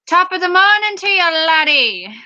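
high, hammed-up mock Irish greeting; attempted Irish accent. Shown as a demonstration. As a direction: put on a lilting Irish accent. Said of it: "I can do an Irish accent".

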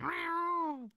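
very high mock meow; cat imitation. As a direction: squeak like the cat. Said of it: "she's like".

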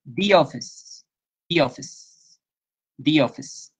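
"The office" with "The" said "di", closed vowel; "the" turns into "di" before "office". Shown as a demonstration. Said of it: "ahí cambia un poquito a I, se cierra".

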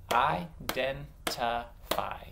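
"Identify" broken into separate beats; "Identify" slowly segmented for blending; slow syllables. Shown as a demonstration. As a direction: clap out "Identify" slowly, pausing between syllables. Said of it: "I'm gonna say them slow and clap".

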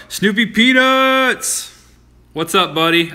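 high, slow drawn-out greeting; excited call. Real, not acted. Streamer greeting a viewer, excited. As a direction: call the name out in delight.

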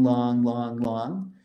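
each long stretched slowly and evenly; drawn-out long beats. Shown as a demonstration. Teacher counting out drawn long beats. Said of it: "a long syllable takes exactly twice as long to say".

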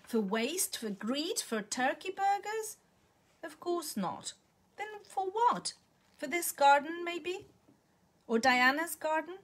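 rising, scornful indignant list. A narrator's reading. Millicent mocking Alison's homesickness, indignant. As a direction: scoff at each reason in turn. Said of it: "says Millicent indignantly".

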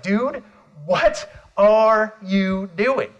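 slow, drawn-out disbelief; acted-out baffled question; incredulous. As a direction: stretch out each word in amazement. Acts out baffled question to Crispin.

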